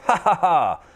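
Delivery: high, hearty laugh; splendid laugh. Acted. Scrooge laughing freely for the first time in years. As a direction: let out a big rusty laugh. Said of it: "a splendid laugh".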